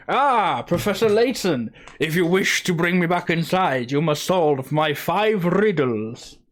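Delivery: high, loud, theatrical talking cat; talking cat with accent. Acted. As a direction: proclaim it as a grand accented cat. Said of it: "do you have that accent".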